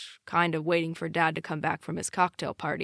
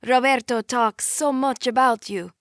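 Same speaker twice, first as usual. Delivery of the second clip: put-on Italian accent, louder; heavy Italian accent; 7 semitones higher, 7 dB louder than usual. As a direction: greet him in a thick Italian accent. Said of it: "super heavy Italian accent".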